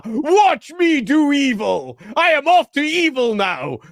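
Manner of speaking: high, slow hammy villain voice; mustache twirling villain. Retold or imitated. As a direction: ham up a cartoon villain. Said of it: "given a character along these lines".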